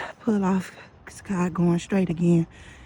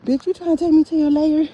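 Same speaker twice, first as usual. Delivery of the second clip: loud, high mock-outraged question; hammed-up indignant challenge; retold accusation; 8 semitones higher, 6 dB louder than usual. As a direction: raise pitch and volume to accuse the driver. Retold accusation, repeated louder.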